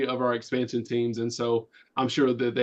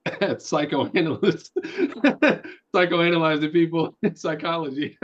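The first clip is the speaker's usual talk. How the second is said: laughing; 5 semitones higher than usual. Real, not acted. Laughing through words.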